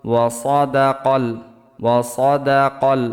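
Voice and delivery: সাদাকল read slowly and clearly; slow model reading. Shown as a demonstration. Teacher modelling the word for students to repeat. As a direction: sound out ওয়া সাদাকল slowly. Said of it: "আমার সাথে সাথে পড়বেন".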